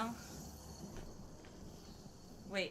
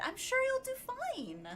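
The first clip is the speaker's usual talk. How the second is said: high, bright peppy cheer; 14 semitones higher than usual. Shown as a demonstration. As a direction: squeal it with cheerful energy. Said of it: "you gotta be peppy with it".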